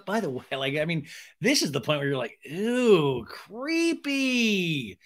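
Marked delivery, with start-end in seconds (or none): 2.5-5.0 drawn-out, high creeped-out squeal; slow, swooping cringe. Acted. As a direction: stretch it out in mock disgust. Acted reaction of disgust.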